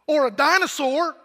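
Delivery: put-on high, loud, eager little boy; excited little kid. Little son telling dad his dream, high and loud. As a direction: blurt it out like a thrilled seven-year-old. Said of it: "his very next sentence was".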